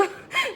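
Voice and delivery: high giggling burst; high amused laugh; burst of laughter. Real, not acted. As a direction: laugh out at the ugly stone. Laughing about dad's ugly crystal.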